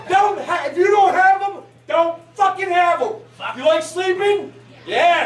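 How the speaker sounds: ordinary delivery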